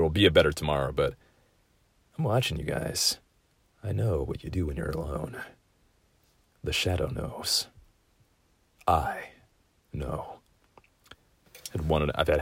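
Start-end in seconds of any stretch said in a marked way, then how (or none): 2.2-7.7 softer, ominous lurking voice; lightly hammed-up creepy narrator; mock creepy voice. Creepy joke about viewers alone. 8.9-10.4 whisper. Pretend. Creepy ending whisper.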